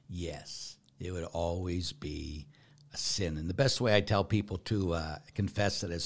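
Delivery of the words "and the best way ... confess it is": usual speaking voice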